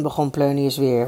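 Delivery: usual speaking voice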